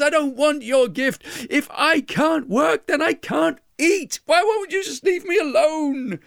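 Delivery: high, loud frantic refusal; shouting weaver. Acted. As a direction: yell it to drive her away.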